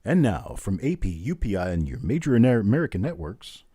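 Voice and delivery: hammed-up radio newsreader intro with swooping pitch; mock news announcer. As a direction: play at announcing the news, spacing out phrases.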